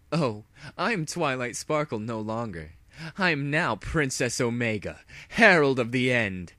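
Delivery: grandiose villain proclamation; lightly voiced mad princess. Villain to hero, grandly declaring herself. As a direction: announce your dark new title with pride.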